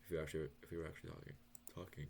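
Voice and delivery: nervous stutter; stumbling over words. Real, not acted. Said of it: "Sorry, I'm stuttering".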